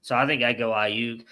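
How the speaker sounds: normal talking voice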